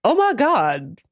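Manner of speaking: mock Southern catchphrase. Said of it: "That's your new catchphrase".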